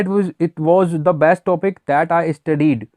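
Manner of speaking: very slow, word by word English reading; slow dictation. Shown as a demonstration. Teacher reads the example sentence to students, very slowly. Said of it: "जैसे नीचे लिखा है".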